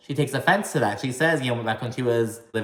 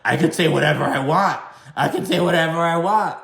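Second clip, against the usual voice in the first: loud, insistent mimicry of defiance; mock doubling down; 4 semitones higher, 4 dB louder than usual. Host mimics a castmate doubling down, mock defiant. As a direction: mockingly dig your heels in, louder on the repeat. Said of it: "she was doubling down".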